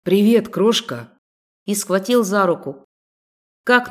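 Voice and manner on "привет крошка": hoarse whisper. Acted. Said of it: "хрипло прошептал".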